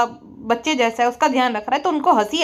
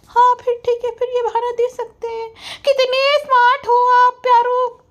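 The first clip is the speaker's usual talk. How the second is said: mock vlogger voice; 10 semitones higher than usual. Roaster mocks the vlogger boasting about success, high smug voice. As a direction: puff up and brag in a squeaky put-on voice.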